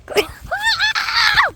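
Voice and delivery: squealing burst of laughter; high shrieking laugh. Real, not acted. Sudden laugh.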